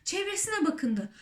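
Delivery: plain reading voice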